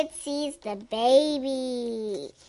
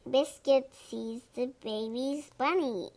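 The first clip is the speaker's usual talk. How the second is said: plain reading voice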